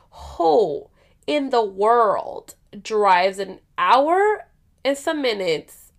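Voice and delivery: "Who in the world" stretched out slowly, word by word; incredulous emphasis. Real, not acted. Asking viewers who drives that far, slow incredulous stress on "Who in the world". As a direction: drag out "Who", "world" and "hour" in disbelief.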